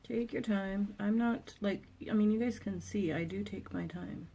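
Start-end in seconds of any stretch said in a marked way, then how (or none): 2.3-4.3 soft unvoiced whisper. Real, not acted. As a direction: say it under your breath. Quiet aside.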